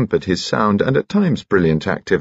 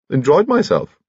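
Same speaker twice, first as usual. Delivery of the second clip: piping, chirpy gentleman's voice; high-pitched little lord; 10 semitones higher than usual. A narrator's reading. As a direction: chirp like a cheerful old bachelor.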